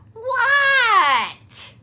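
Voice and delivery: high, loud yelled What; Froggy yelling back to mother. A narrator's reading. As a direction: yell back from outside. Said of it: "yelled Froggy".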